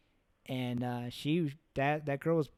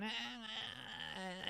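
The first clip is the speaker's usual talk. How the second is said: much softer, strained little gasps; imitating deer's faint gasps; 6 semitones higher, 14 dB quieter than usual. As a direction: mimic a dying animal's breath. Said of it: "the baby deer's going".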